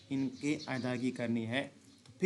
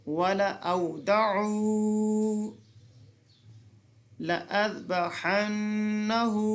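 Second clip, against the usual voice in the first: slow, higher, long held chant; Quranic recitation; 4 semitones higher, much slower than usual. Shown as a demonstration. Teacher reciting the correct way for students. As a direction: recite each word drawn out. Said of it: "कैसे पढ़ेंगे?".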